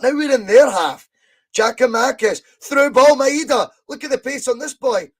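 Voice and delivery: excited play-by-play shout; rising excitement. Real, not acted. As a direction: call the break with growing thrill. Live attack building, commentator rising.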